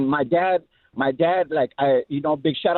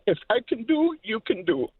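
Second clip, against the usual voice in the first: put-on light Mexican accent; 6 semitones higher than usual. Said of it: "such a heavy accent".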